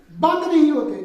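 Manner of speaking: usual speaking voice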